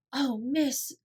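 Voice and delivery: breathless with gratitude; gasped thanks. A narrator's reading. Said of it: "she gasped".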